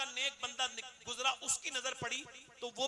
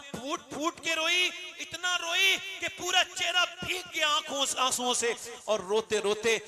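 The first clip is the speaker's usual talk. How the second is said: louder, higher, slower sermon delivery; impassioned preaching; 7 semitones higher, 8 dB louder than usual. Acted. Preacher to the congregation, loud and higher in fervour.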